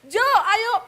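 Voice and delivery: high loud call, then checked. Retold or imitated. Wife calling husband, loud high. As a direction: shout the name high. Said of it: "saya harus mengingat-ingat setiap kali manggil dia".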